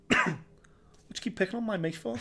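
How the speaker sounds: lightly acted, slow challenge; retold confrontation. Retelling to co-host how he confronted Moicano, mock tough. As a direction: needle him like a mate defending a friend. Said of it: "But I basically just went".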